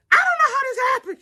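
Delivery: high, fast mock wail; imitation of tearful bewilderment; bawling. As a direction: wail it helplessly, hammed up. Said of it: "I started bawling like".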